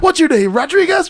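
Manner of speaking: high-pitched mock fight commentary; Mero. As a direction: call out the name in a high teasing voice. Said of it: "And he goes".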